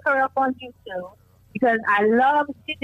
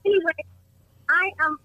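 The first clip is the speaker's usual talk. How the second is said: ordinary talk, nothing marked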